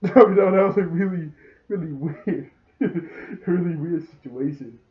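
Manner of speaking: real laughter breaking through the words; laughing. Real, not acted. Laughs at an odd scene.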